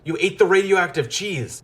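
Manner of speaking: shocked scientist exclaiming; horrified outburst. A narrator's reading. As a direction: Tobias aghast at the mouse. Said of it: "gasped, horrified".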